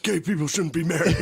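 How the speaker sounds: mock bigot. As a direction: ham it up as a clueless bigot. Mocking himself as bigot.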